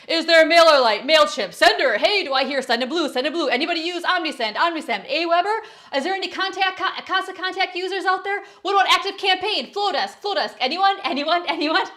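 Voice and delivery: loud, high hammed-up auctioneer calling bids. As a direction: rattle off the names like a playful auctioneer. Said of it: "get my best auctioneer voice".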